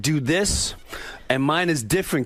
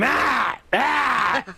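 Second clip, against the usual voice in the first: imitated cries of pain; 9 semitones higher than usual. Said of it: "I hear him going".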